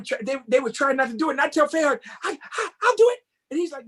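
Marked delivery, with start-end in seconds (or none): 2.2-3.2 much higher, eager stammer; eager volunteering. Retold or imitated. Young dancer begging Frayard for the solo, eager. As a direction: jump at the chance.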